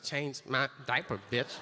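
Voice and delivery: low, soft mock rapper swagger; lightly voiced rapper, laid back and cocky; a hint of a rapper, low and soft. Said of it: "Rappers should wear them in videos and be like".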